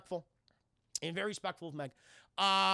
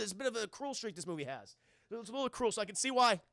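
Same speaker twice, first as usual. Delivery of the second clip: ordinary talk, nothing marked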